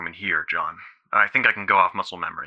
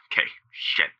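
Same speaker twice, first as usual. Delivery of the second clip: shaky whispered sigh; 5 dB quieter than usual. Acted. Overwhelmed caller breaking down.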